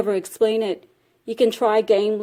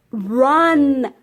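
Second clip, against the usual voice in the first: high, loud cry to flee; sudden long warning call; urgent shout; 5 semitones higher, 6 dB louder than usual. Acted. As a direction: yell it out, stretching the vowel. Rabbit urges freed boy to flee.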